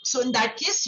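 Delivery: usual speaking voice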